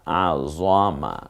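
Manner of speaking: ordinary delivery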